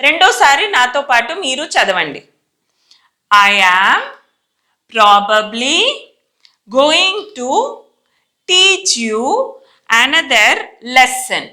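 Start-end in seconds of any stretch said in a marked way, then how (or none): 3.3-11.4 word groups separated by long pauses; careful, halting teacher dictation; model reading. Shown as a demonstration. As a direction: read it phrase by phrase for learners. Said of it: "first time నేను చదువుతానండి".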